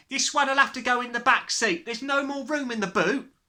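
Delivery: loud, high, careless mother; a brash mother packing the car. A narrator's reading. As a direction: talk over the fuss. Mother talking about suitcases.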